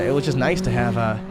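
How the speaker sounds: usual speaking voice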